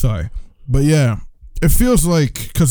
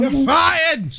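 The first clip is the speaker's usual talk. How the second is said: high-pitched mock boss shout; shouted firing; 8 semitones higher than usual. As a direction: echo the firing as a high yell. Echoing the acted firing.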